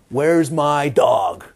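loud, slow bellow; booming, drawn-out hunter's call; pig hunter shout. Acted. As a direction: holler it, stretching each word. Acts out pig hunter calling.

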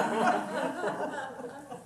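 high, airy laugh at own joke. Real, not acted. Teacher laughing after teasing the class, high and airy. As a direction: let a real laugh spill out high.

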